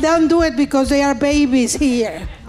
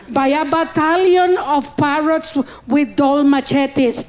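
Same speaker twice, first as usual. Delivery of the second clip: ordinary delivery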